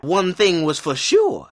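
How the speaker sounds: ordinary delivery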